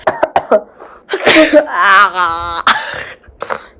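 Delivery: loud cough breaking into a cry; real hacking cough with a pained "ah"; painful cough. Real, not acted. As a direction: cough hard, then groan out in pain. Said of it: "That hurt".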